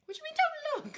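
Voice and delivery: high, incredulous question. Real, not acted. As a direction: sound baffled. Baffled by don't look.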